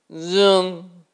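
loud high zooming whoosh. Acted. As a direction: shout zoom like a speeding dragonfly. Dragonfly whoosh sound effect.

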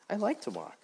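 lively Grasshopper. Acted. Grasshopper voiced in story.

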